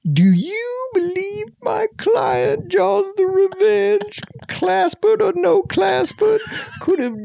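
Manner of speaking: high, slow, pleading courtroom lawyer; Southern lawyer. Shown as a demonstration. Playing a Southern lawyer questioning a witness, high and slow. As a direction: plead to the jury, high and drawn out. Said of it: "I'm gonna do Southern Lawyer".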